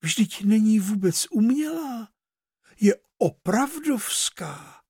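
put-on a spoiled girl's mild disgust; indignant disgust. A narrator's reading. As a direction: spit out her disappointment.

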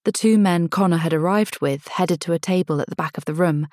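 plain reading voice

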